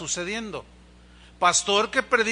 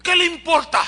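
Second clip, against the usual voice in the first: high, loud mock retort; snapping mother; 9 semitones higher, 9 dB louder than usual. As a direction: throw it back at him, offended. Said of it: "Y me miró así". (replicando ofendida, aguda y fuerte)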